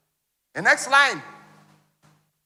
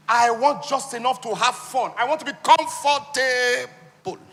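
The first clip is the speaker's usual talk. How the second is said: high, loud, slow, longing; mock longing cry; loud pleading wish; 7 semitones higher, 7 dB louder, slower than usual. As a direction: stretch out each want like a craving. Voicing a poor person's wishes.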